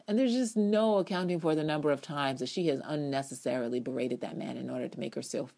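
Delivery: usual speaking voice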